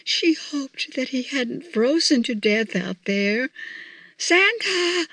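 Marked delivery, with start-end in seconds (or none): 4.2-5.1 mock scream. A narrator's reading. Missus Claus searching the snow for Santa, a restrained scream. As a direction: cry the name out high, as if screaming. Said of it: "screamed Missus Claus at the top of her lungs".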